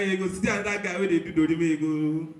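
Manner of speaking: normal talking voice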